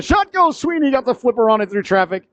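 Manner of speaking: sudden thrill at the save; excited save call. Real, not acted. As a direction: pitch leaps up, then eases down.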